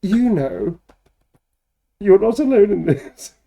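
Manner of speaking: mock dramatic. Shown as a demonstration. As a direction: ham up the comforting line. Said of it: "taken on a whole new meaning".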